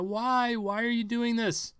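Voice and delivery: panicked plea. Retold or imitated. Vlogger imitating a panicked witness begging the shooter. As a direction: beg in a panic. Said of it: "I could see somebody panic".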